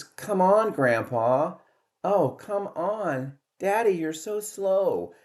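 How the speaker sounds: mock the older guy in a high, drawn-out sneer; bitchy taunting. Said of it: "really bitchy the way they said it".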